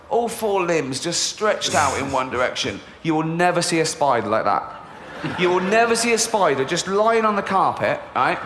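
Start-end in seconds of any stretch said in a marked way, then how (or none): none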